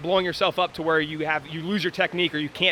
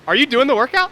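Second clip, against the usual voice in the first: surprised; 11 semitones higher, 9 dB louder than usual. Real, not acted. Surprised a guest is joining in.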